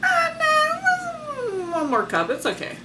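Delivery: a high, drawn-out mock plea; mock whining other person. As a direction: whine it out, then brush it off. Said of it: "this other person is like".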